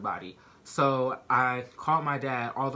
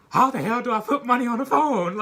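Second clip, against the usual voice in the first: high, loud, laughing through the dad's baffled question; laughing dad quote; 10 semitones higher, 8 dB louder than usual. Retold or imitated. Laughing while telling viewers what Dad asked about the jail phone. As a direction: crack up while quoting a confused dad.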